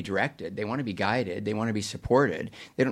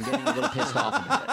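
laughing through the words; high, fast, breaking into laughter; 13 semitones higher, faster than usual. Real, not acted. Joke about never getting a raise.

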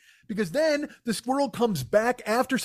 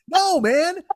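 usual speaking voice

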